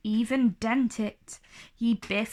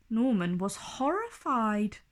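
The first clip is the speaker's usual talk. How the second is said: ordinary delivery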